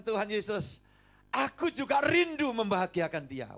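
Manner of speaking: impassioned preacher voice; preaching at full force. Acted. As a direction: lift the voice in fervent sermon.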